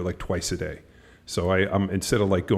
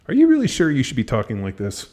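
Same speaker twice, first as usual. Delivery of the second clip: higher, louder incredulous question; acted-out skeptical put-down, pitched up; mock challenge; 5 semitones higher, 5 dB louder than usual. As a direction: challenge him as if in disbelief. Acted challenge to a loudmouth spectator.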